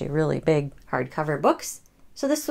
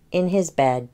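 ordinary delivery, nothing marked

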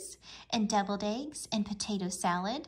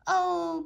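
loud, higher group groan; dismayed groan; 8 semitones higher, 7 dB louder than usual. A narrator's reading. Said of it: "groaned everyone".

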